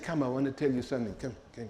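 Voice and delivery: fast, then dropping very soft; beckoning quickly. Retold or imitated. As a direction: call him over, then go hushed. Daughter beckons clerk closer.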